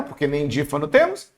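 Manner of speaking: normal talking voice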